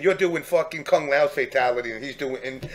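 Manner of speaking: usual speaking voice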